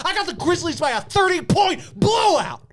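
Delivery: high, loud triumphant call; a host loudly declaring the game winner; shouted pick. Real, not acted. Bold sports pick declared.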